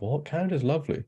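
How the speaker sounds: incredulous mock gush about Canada. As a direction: act shocked anyone would doubt it. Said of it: "they'd be like".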